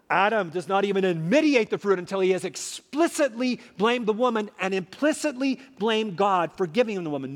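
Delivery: normal talking voice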